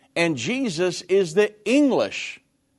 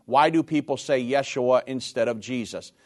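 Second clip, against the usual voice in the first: normal talking voice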